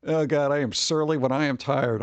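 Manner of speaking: usual speaking voice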